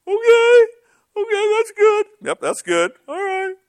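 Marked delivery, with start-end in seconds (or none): 0.1-2.1 very high, loud falsetto reply; mock tearful voice. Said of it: "they cried". 3.1-3.6 very high falsetto; mock tearful voice. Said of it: "they cried".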